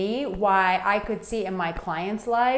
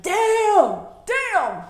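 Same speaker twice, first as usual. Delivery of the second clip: stunned "Damn", much higher and louder; Friday "damn"; 12 semitones higher, 4 dB louder than usual. Retold or imitated. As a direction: let it drop like the Friday movie scene. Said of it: "it's like the scene from Friday".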